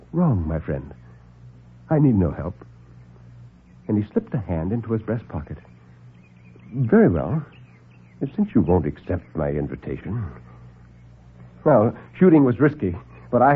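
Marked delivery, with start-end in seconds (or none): 0.1-2.6 low, unbothered doctor; calm superior doctor. Acted. Doctor calmly dismisses Jack's warning. 6.7-10.2 low, unbothered doctor; calm superior doctor. Acted. Doctor calmly dismisses Jack's warning.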